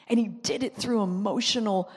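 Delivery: ordinary talk, nothing marked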